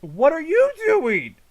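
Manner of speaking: high, loud, drawn-out exasperation; loud outburst at useless soldiers; exasperated disbelief. Real, not acted. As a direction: yell at them in disbelief, stretching the words. Baffled by soldiers in trailer.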